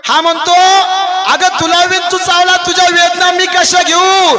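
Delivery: high, loud kirtan storytelling; full-force preaching. Acted. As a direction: preach the tale out to the crowd.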